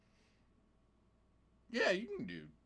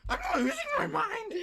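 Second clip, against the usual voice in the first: manic laughing. Real, not acted.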